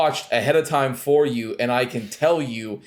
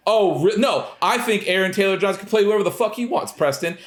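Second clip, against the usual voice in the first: higher-pitched mock gushing defense; sarcastic retort; 7 semitones higher, faster than usual. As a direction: throw the friend's argument back, mockingly. Sarcastically parodies friend's defense argument.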